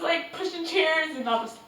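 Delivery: ordinary delivery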